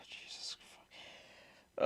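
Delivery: slow, barely audible whispered curse; real hushed dismay; stunned whisper. Real, not acted. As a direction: whisper it in drawn-out disbelief. Stunned reaction to the story.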